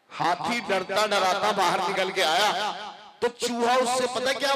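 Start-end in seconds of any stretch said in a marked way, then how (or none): none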